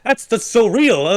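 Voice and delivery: loud, high-pitched retold panic; mock childlike alarm; scared six-year-old. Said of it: "like a six-year-old".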